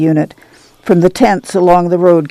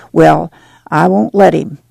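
ordinary delivery

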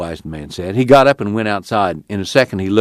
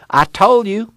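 raised, eager and insistent; excited urging; 11 semitones higher than usual. A narrator's reading. Casey urging Tom to bring the folks, excited. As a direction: keep the fire of the cry going. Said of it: "he cried".

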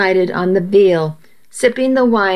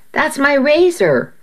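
a mild read-aloud shout, higher; 7 semitones higher than usual. A narrator's reading. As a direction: call out sharply, lightly acted. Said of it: "I heard Sam shout".

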